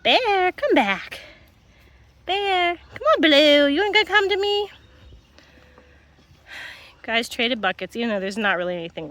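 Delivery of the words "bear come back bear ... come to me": high, sing-song voice coaxing a wary dog; high coaxing pet voice; coaxing dog talk. Real, not acted. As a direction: sweet-talk the dog over.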